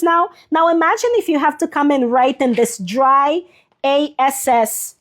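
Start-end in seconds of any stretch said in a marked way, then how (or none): 3.8-4.9 A S S said slowly as three separate letters; A S S spelled out letter by letter to dodge the filter. Real, not acted. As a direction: spell the word letter by letter instead of saying it. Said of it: "'cause I can't say 'cause of A I".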